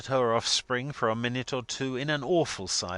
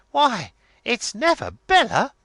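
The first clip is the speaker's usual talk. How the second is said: high, loud cry of surprise; astonished exclamation; 13 semitones higher, 10 dB louder than usual. A narrator's reading. Miss Lavvy startled at seeing her sister, astonished exclamation. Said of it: "exclaimed Miss Lavvy".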